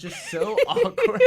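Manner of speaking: bursting into loud laughter; cracking up hard; laughing. Real, not acted. Cracking up at memory.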